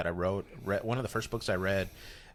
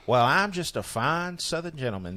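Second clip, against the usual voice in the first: Kenny Crawdads Southern gentleman; 6 semitones higher than usual. Acted. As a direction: play a pompous Southern gentleman. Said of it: "the character, Kenny Crawdads".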